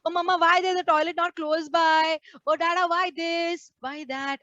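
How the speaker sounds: a hint of a complaining kid; whiny children. Preacher imitates children complaining to their parents, mild whine. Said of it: "the children would say".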